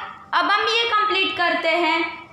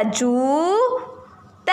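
जूता stretched out very slowly; slow reading aloud; much slower than usual. Shown as a demonstration. Teacher reading a word while children write, slow. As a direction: draw the word out as pupils write it. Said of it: "तो हमें लिखना है आ की मात्रा".

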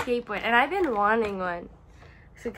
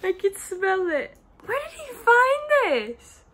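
excited; 9 semitones higher, 6 dB louder than usual. Real, not acted. Thrilled over candle.

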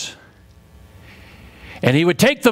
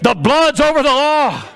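higher, more forceful preaching cry; insistent call; 7 semitones higher, 3 dB louder than usual. Shown as a demonstration. Preacher pushing the congregation to say it properly, insistent call. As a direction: demand it back from the crowd. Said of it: "No, say it".

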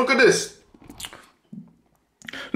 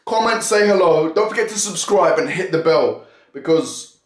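usual speaking voice

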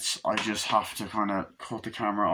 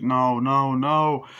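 loud, raised cries; mock terrified pleading; 4 semitones higher, 7 dB louder, much slower than usual. Fallen man on the escalator, terrified pleading. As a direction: plead in acted panic. Acting victim stalked on escalator.

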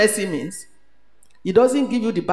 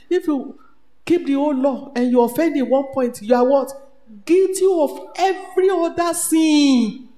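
rising preaching; 6 semitones higher, 5 dB louder than usual. Acted. Preaching climax.

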